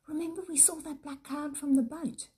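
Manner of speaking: bright eager question to the others; excited. A narrator's reading. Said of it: "Peter said excitedly".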